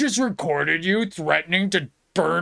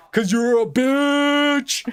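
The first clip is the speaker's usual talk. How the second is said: higher, mocking jab; taunt; 5 semitones higher, slower than usual. Streamer taunting Rob, mocking jab. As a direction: spit the insult as a tease.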